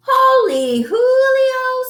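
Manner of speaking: high slow squeal. Acted. Kitty boy amazed at the mirror, high and loud. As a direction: squeal it out in surprise. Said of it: "squealed the kitty boy".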